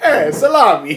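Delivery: laughing, hammed-up Italian accent; mock Italian accent. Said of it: "That was Italian".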